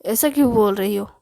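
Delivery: plain reading voice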